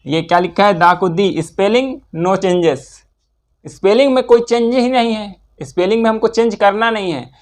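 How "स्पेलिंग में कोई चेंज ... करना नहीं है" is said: ordinary talk, nothing marked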